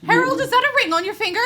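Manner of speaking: high, loud, rushed exclamation; excited outburst. Acted. Friend spots a ring on Harold's finger, excited shout.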